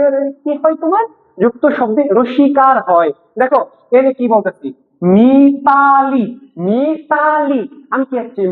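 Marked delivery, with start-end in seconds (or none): 5.1-7.6 MITALI stretched slowly, long vowels; drawn-out word. Shown as a demonstration. Teacher sounds out example word. (শব্দটা টেনে টেনে ধীরে)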